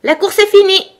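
sudden high, loud announcement; abrupt shout. A narrator's reading. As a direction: cry out to stop everyone at once. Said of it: "le dodo cria brusquement".

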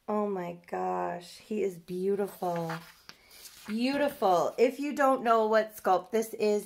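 ordinary talk

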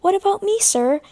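high, eager little engine voice; young engine asking to help; Percy's voice. A narrator's reading. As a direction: pitch it up like a keen small engine. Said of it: "asked Percy".

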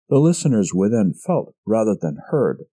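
ordinary delivery, nothing marked

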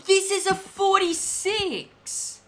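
high, loud indignant yelling; incensed, voice shooting way up; outraged. Real, not acted. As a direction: shout back at the scammer in disbelief. Accused of scamming after being scammed.

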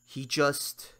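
usual speaking voice

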